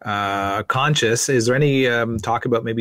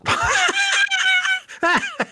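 loud burst of laughter; shouted high laugh; 4 dB louder than usual. Real, not acted. Laughs at host eating pie.

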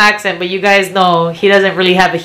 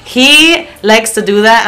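normal talking voice

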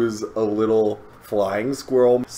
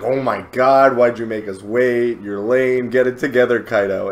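normal talking voice

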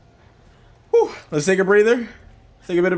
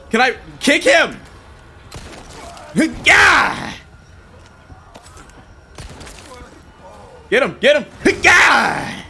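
excited shouting; 8 semitones higher, slower than usual. Real, not acted. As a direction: whoop as you land the kick. Fighting enemies, laughing shouts.

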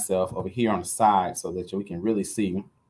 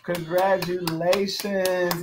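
very slow, drawn-out, higher-pitched praise; celebratory congratulations; 6 semitones higher, much slower than usual. Real, not acted. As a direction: stretch out the congratulations to crown the winner. Celebrating the winner.

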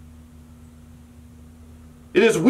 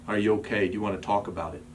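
softer, caring question to someone hurting; gentle check-in; 8 semitones lower, 9 dB quieter, faster than usual. Pretend. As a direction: ask quietly, as if leaning in to a friend. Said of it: "see someone who's hurting and say".

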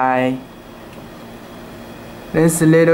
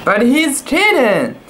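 higher, lightly voiced protest; mock shout; 8 semitones higher than usual. A narrator's reading. Magistrate protesting to the diplomat, mild mock shout. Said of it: "shouted the foolish magistrate".

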